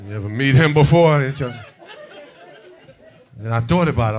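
usual speaking voice

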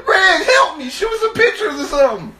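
high, loud, frantic yell; urgent call for help. Retold or imitated. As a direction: call out desperately to a slacking helper. Calling to cameraman for help.

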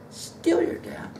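whisper. Acted. Whispered repeat of refrain.

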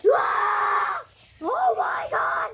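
high, loud shriek of fright; play-acted scream. Puppet character startled by an attacker, loud panicked scream.

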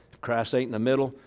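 ordinary delivery

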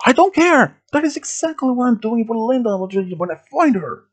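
very high, loud angry scream; screamed fury. A narrator's reading. Gunman refusing to let them help, screaming. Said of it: "he screamed".

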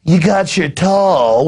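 drawn-out mocking counter patter; mock trendy barista. Host mimicking the trendy behind the counter, mock drawl. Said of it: "they'll have the trendy behind the counter go".

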